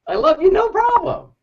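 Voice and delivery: very high, chirpy quote of himself; high mock-cheerful yes; mock eager yes. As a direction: squeak it out like an overeager helper. Mocking his own eager reply.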